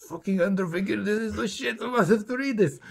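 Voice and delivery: high, hushed fanboy excitement; mock-breathless raving to a friend; retold gushing. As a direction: imitate your young self gushing, high and soft. Retelling own childhood gushing to friend.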